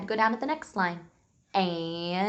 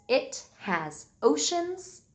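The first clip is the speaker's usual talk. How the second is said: slow dictation; slower than usual. Shown as a demonstration. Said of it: "come up with a sentence".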